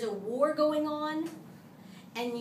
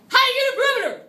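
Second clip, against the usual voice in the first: high, loud call-out voice; weird voice; 10 semitones higher, 14 dB louder, much faster than usual. Shown as a demonstration. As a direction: shout it in a silly high voice. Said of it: "weird motions and weird voices stick in your brain".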